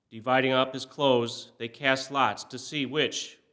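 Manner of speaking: ordinary delivery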